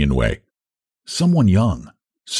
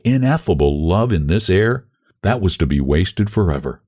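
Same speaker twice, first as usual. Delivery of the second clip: ordinary delivery, nothing marked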